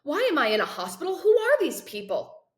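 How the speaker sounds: high, fast, loud rising panic; panicked questions. Acted. Boy wakes among strangers and demands answers, fast and loud. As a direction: fire the questions out in alarm.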